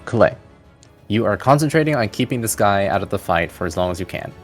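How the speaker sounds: ordinary talk, nothing marked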